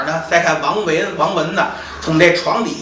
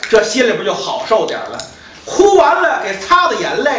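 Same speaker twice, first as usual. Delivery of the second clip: plain reading voice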